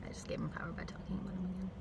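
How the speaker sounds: fast whispered aside; rushed guilty whisper. Real, not acted. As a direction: hush it quickly to yourself. Self-mocking aside, unvoiced.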